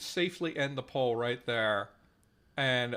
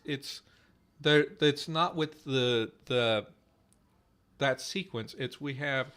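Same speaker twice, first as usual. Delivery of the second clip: normal talking voice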